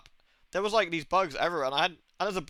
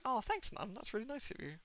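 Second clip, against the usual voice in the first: higher, much softer quoted thanks; soft polite thanks; 6 semitones higher, 11 dB quieter than usual. Retold or imitated. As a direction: act out a small, hushed thank-you to Mum. Voicing his thanks to his mum.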